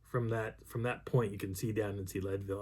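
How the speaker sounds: usual speaking voice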